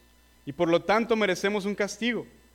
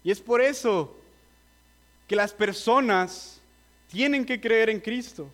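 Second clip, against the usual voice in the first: usual speaking voice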